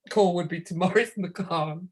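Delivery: ordinary talk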